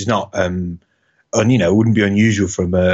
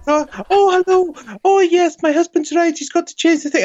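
hammed-up high falsetto lady voice, laughing; much higher mock woman's greeting; Mrs Doubtfire lady. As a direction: play the wife in a silly falsetto. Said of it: "female accent I can do is".

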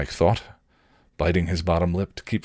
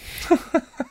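short breathy laugh; small high chuckle. Real, not acted. Reader chuckles at the odd story.

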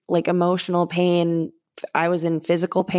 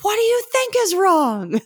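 high, sharp mock snap back; throwing the question back at him in disbelief; exasperated retort. To her fiance asking what's wrong, an exasperated high retort. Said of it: "And I was like".

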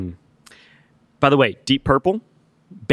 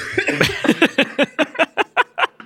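high wheezing laugh; breathless fit of laughter; choking laugh. Real, not acted.

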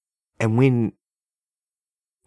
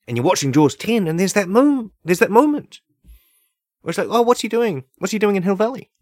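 high and loud, climbing faster; rising excitement; 11 semitones higher, 6 dB louder than usual. Real, not acted. Fan retelling the big movie moment, building excitement. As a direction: get more and more worked up.

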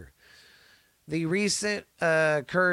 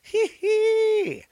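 high cackling laugh; 4 dB louder than usual. Real, not acted. Laughs at own birthday joke.

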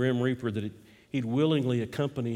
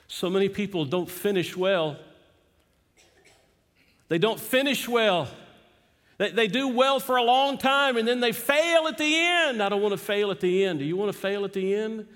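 rising preacher's fervor; preaching; 9 semitones higher, 5 dB louder than usual. Acted. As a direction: build it up like a preacher. Preaching builds to a climax.

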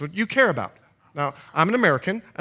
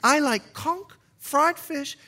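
higher, lilting Bahamian accent; 8 semitones higher than usual. Real, not acted. Preacher tells church of his island roots, Bahamian accent. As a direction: let the Bahamian accent come out. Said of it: "you can hear a little bit of my accent coming out".

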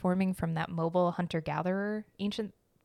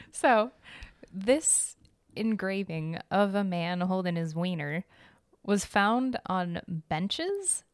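ordinary talk

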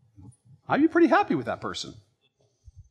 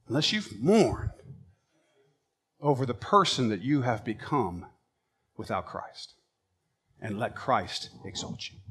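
much softer, almost breathed; hushed; 7 semitones lower, 8 dB quieter than usual. Acted. Preacher urging sinners to mourn, much softer and hushed. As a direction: draw them in with a hush. Drops to near whisper.

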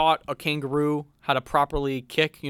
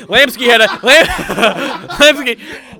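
higher, louder words broken by laughter; laughing through commentary; 9 semitones higher, 12 dB louder, much slower than usual. Real, not acted. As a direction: crack up while trying to say the name. Commentator cracking up mid-call.

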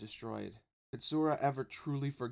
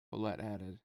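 ordinary delivery, nothing marked

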